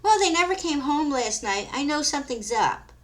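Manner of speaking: worried mother. Acted. Mother tells officer.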